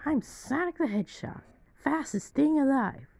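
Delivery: cartoon hedgehog squeak; Dreamcast Sonic voice. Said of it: "his Dreamcast voice".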